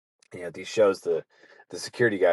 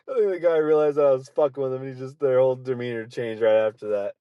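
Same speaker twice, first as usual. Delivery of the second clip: high squeezed voice, settling after a laughing fit; talking through laughter; 5 semitones higher than usual. Real, not acted. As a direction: start high and laughing, gradually calm down. Still laughing at own story.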